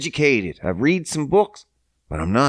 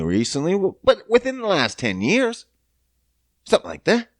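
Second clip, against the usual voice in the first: usual speaking voice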